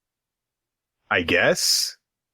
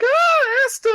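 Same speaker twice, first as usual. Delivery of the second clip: hammed-up fangirl squeal; swooning girl; 4 dB louder than usual. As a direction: squeal his name in mock fluster. Acting girl flustered by Asta.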